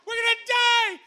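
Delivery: high, loud panicked scream to the crew; retold scream. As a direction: wake everyone, yelling that we're about to die. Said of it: "And I scream".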